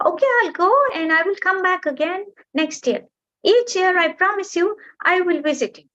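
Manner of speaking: high, bright little bird goodbye; cheerful bird farewell. Acted. Bird saying goodbye to the mountain, high and fond. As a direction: part fondly from a friend.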